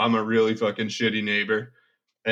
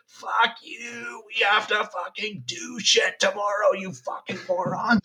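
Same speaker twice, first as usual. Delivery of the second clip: high, imitated furious yell at the neighbors; mock yell; 10 semitones higher than usual. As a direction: yell up through the floor, hammed up. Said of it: "two foot stomping on the floor and just like".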